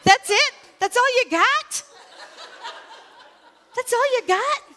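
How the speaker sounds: high, loud laughing taunt; high, laughing mock challenge. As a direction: tease them with a loud laugh.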